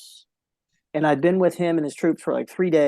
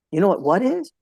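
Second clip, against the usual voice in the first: normal talking voice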